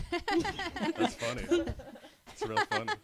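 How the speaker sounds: high, slow laughing fake amusement; sarcastic giggly retort; mock laughing reply. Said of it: "Then I'd be like".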